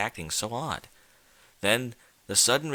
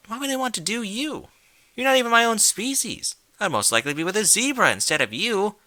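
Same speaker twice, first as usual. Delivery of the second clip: much higher, indignant mare voice; rising disgust, louder on "species"; disgusted Twilight; 11 semitones higher, 4 dB louder than usual. Acted.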